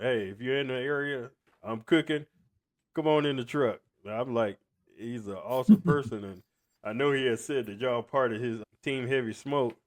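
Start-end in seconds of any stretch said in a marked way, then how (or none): none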